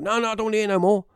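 high, rapid imitation of a spooked mate; retold panic, words rushed high; panicked refusal. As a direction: refuse in a fast, frightened put-on voice. Spooked mate refuses to keep listening.

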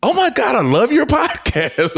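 put-on very high, excited old lady voice with a laugh; squealing elderly fan; old lady gushing. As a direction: gush like a thrilled grandma fan.

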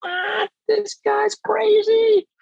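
high, slow disbelieving squeak; incredulous. Retold or imitated. To himself about the staple-gun guy, high incredulous squeak. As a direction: react like he's lost his mind. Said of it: "And I'm like".

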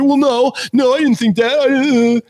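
high, whiny mock of a caught-out troll; playing the troll as a squeaky, panicked whiner; whiny flustered troll. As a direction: squeal the denial like a cornered heckler. Mocking troll in retold argument.

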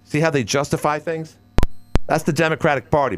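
ordinary talk

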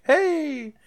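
high-pitched sing-song gloating, last words stretched out; mock the players crowing like giddy kids; gleeful taunting. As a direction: squeal it in mock triumph. Mimicking players gleeful at extra gold.

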